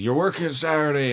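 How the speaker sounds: mock order from the boss; bossy boss. Son retells boss ordering Saturday work, bossy. Retold argument with his boss.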